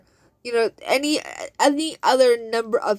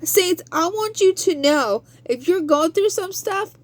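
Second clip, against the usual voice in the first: rising preaching; 7 semitones higher than usual. Real, not acted. Preaching build to congregation.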